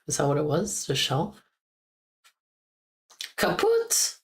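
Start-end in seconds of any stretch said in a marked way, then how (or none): 3.4-4.2 mock squeak. Exclaiming at game failure.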